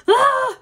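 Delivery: sudden high, loud mock scream; husband's deadline makes her yelp in mock panic; panicked yelp. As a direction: shriek like time just ran out. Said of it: "I'm like".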